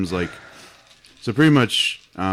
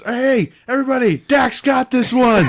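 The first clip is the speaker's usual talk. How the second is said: mock shout; 6 dB louder than usual. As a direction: bellow it like a cocky idiot. Retelling Family Guy character shouting.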